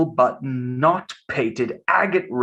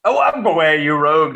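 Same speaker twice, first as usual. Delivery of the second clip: ordinary talk, nothing marked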